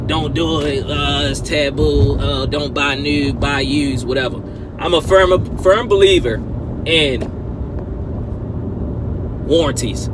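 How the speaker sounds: ordinary talk, nothing marked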